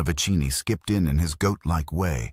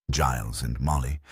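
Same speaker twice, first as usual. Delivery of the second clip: plain reading voice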